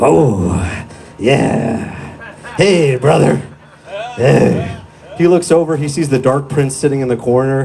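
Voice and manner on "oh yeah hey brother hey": gruff wrestler drawl, hey brother; Hulk Hogan. Retold or imitated. Comic imitating Hulk Hogan arriving in heaven, gruff. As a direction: channel a pro wrestler hamming it up. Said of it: "and he's like".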